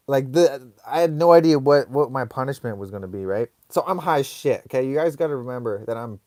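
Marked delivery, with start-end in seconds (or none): none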